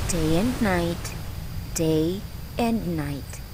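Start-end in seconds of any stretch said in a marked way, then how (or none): none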